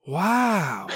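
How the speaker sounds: mild mock amazement; a small impressed wow; amazed wow. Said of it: "react by just going".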